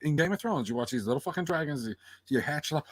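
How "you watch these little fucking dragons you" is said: ordinary talk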